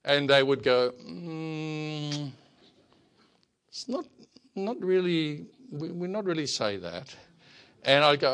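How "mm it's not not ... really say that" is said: hesitant Thai friend. Retold or imitated.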